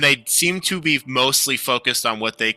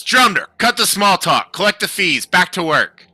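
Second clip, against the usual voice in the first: higher, louder gruff guard order; stern guard; 7 semitones higher, 5 dB louder than usual. Acted. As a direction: bark it like a bored senior guard. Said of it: "One of the other guards says".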